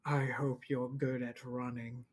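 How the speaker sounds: understated, low wicked threat; wicked villain's threat. Acted. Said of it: "And he goes".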